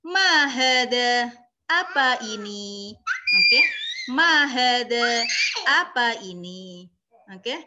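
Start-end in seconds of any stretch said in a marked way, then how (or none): 0.0-3.0 "Ma hadza" slow and loud, then "apa ini" called out; Arabic phrase then gloss. Shown as a demonstration. Teacher giving phrase and meaning, slow and emphatic. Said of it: "Artinya apa?". 4.2-6.9 "Ma hadza" slow and loud, then "apa ini" called out; Arabic phrase then gloss. Shown as a demonstration. Teacher giving phrase and meaning, slow and emphatic. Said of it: "Artinya apa?".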